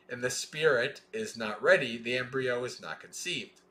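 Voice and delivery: measured, deliberate scripture reading; sutta recitation. Acted. Teacher reading the sutta to students, measured recitation. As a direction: recite each line of the chain steadily.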